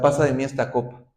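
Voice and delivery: rushed off fast and shallow; a quick careless "Pasa de mí esta copa"; hurried plea. Acted. Nos quedamos hasta.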